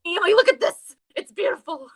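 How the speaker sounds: high, loud imitation of upset pleading; retold yelling. Imitating Mayu making him look, high mock yell. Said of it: "yelling, like".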